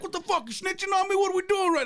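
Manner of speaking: very high, incredulous comic rant; acted outraged protest. Inmate confronting a snitch, acted high-pitched outrage. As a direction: protest in hammed-up disbelief, pitch way up.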